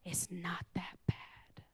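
hushed, breathy aside to the congregation; whisper. Real, not acted. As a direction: drop to a whisper for the punchline. Preacher drops to a hushed aside.

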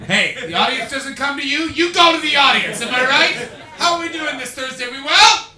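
high, very loud crowd hype; rallying shout. Real, not acted. Comedian rousing the audience, high rallying shout. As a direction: shout to fire up the whole room.

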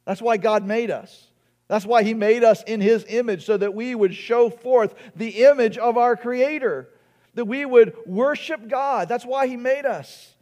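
impassioned preaching. Real, not acted. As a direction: preach it high and emphatic. Preaches with rising force.

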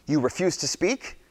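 a sharp high-pitched challenge; demanding. Acted. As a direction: press him for an answer. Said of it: "Pilate demanded".

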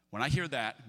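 usual speaking voice

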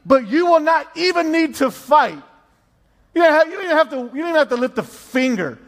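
emphatic, excited pulpit delivery; preaching. Acted. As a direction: preach the promise with rising force. Preaches the promise of verse seventeen.

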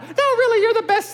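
put-on very high, sweet girl's voice; imitating daughter. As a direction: chirp like his adoring daughter. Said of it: "when I was her".

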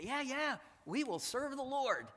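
high, loud, eager mock promise; a breezy, overeager yes from the people; eager crowd reply. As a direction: play the crowd promising too quickly. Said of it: "They respond and say".

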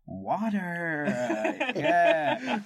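mock American realisation with a long final R; imitated American accent. Americans finally getting the word, imitated American accent. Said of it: "And they go".

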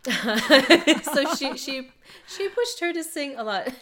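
laughing. Real, not acted. Amused telling of pushy mother.

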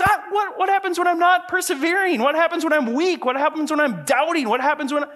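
high, pressing string of anxious questions; frantic questioning. Acted. Preacher voicing a believer afraid of failing, frantic.

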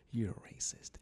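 breathy whispered aside. Real, not acted. Host telling Trump supporters, whispered. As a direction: drop it to a whisper, like a secret.

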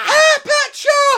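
mock a starstruck fan spotting him; fan's shriek. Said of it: "she went".

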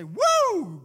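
high, very loud whoop; joyful shout. Pretend. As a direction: shout woo for joy. Bold believer shouting for joy.

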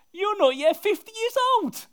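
high, loud scoffing voice; mocking crowd. Voicing the sceptical crowd.

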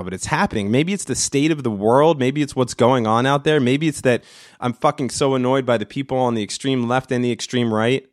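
ordinary delivery

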